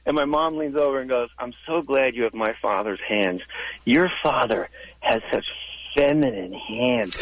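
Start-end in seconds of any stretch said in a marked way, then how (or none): 1.4-7.2 low, slow, drawn-out distaste; repulsed mom. Acted. Mom leans over at dinner to confide, slowly repulsed. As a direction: stretch the words out in mock revulsion. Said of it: "she was, like, repulsed".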